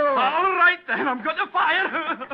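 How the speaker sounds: high, slow hysterical threat; panicked threat. Acted. Stewart about to fire at the visitor, high hysterical threat.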